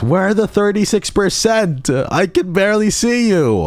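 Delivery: loud, high call to chat. Real, not acted. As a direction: shout out to find the fans. Calling out to chat.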